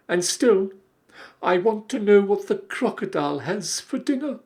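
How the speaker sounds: put-on high, pleading young elephant; a small curious child, complaining.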